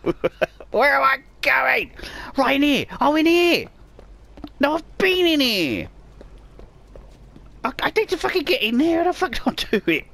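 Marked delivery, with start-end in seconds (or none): 0.7-9.0 high, raised exasperated voice; exasperated rise, falling off at each end; frustrated. Real, not acted. Lost player going in circles in the game, frustrated.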